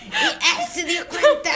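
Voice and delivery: high, drawn-out mock possessed voice; hammed-up horror-film dubbing; exorcist imitation. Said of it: "Me hiciste como el exorcista".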